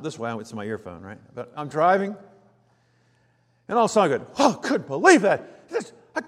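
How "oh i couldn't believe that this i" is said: much higher, louder indignant driver; mock outraged driver on the phone, fast at the end; road rage rant. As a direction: rant at the driver who cut you off. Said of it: "Just like this".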